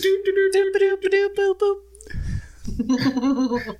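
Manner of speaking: high sung news jingle doops. Pretend. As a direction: sing a cheesy news sting. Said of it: "breaking news".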